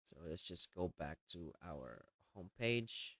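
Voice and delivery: ordinary delivery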